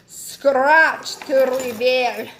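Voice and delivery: slow, drawn-out mock Scottish accent. Reading the box card aloud in a playful Scottish accent. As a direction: read the card like a hammed-up Scot. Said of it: "That was my best, ... Scottish accent".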